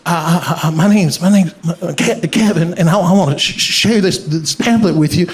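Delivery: imitated stammer, words repeated and broken; stuttering. Nervous pamphleteer introducing himself to a stranger, stuttering. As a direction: get stuck on first sounds, push through. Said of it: "he's, like, stuttering".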